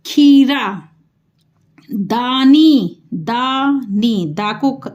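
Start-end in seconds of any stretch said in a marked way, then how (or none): 0.1-0.9 खीरा with the खी drawn out long; stretched long ई. Shown as a demonstration. Teacher to class, drawing out खी in खीरा. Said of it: "खी को खींच के बोला". 1.9-4.3 दानी very slow, दा held long; slow syllable demo. Shown as a demonstration. Teacher spelling out दानी slowly for pupils. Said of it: "दा पे आ की मात्रा".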